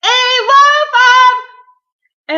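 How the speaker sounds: high, very drawn-out yell; top-of-voice shout. A narrator's reading. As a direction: shout as loud as you can, then break off. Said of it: "started shouting at the top of his voice".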